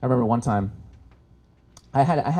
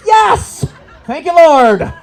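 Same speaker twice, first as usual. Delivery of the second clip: much higher and louder, laughing; gleeful cheer; 11 dB louder, much slower than usual. Retold or imitated. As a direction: celebrate like a win. Cheering the two-on-one.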